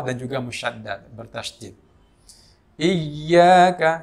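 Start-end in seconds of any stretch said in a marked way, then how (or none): 2.7-4.0 Iyyaka with doubled y, flowing voice; iyyaka with tasydid. Shown as a demonstration. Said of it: "suara mengalir tapi disertai dengan tasydid".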